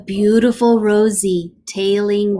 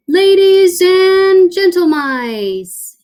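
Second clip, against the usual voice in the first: higher, louder, slow squeaky announcer; squeaky showman; 9 semitones higher, 7 dB louder, slower than usual. Acted. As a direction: announce it like a grand showman mouse. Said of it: "he squeaked".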